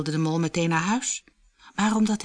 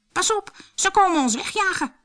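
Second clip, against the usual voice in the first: very high, louder warning; alarmed cry; 14 semitones higher, 5 dB louder than usual. A narrator's reading. Bertje warns they will be chased off, alarmed cry. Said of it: "riep Bertje".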